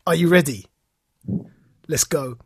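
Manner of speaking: ordinary talk, nothing marked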